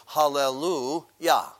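Hallelujah said slowly, each syllable drawn out. Shown as a demonstration. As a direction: spell out Hallelujah slowly for the class. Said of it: "you might recognize this word".

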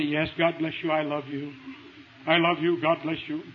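low, soft, practiced sweetness; smiling blessing. Retold or imitated. Preacher acts out his rehearsed reply to a critic, soft smiling blessing. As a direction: imitate a meek, smiling reply under attack. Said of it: "I smile, I say".

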